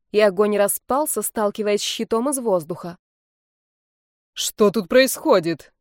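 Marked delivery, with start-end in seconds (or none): 4.3-5.7 slow, loud, menacing rector; stern shout. Acted. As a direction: roar at the class. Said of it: "Грозный крик".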